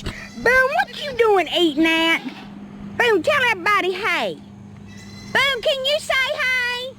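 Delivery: squeaky cooing to an animal; high pet voice. Real, not acted. Coaxing a goat to bleat.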